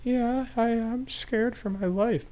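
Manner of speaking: squeaky frightened victim; high, slow mock terror; scared high voice. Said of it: "And you go".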